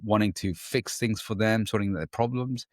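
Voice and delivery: normal talking voice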